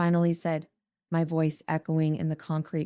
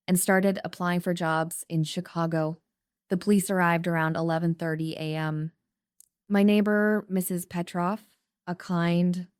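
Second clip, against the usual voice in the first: ordinary delivery, nothing marked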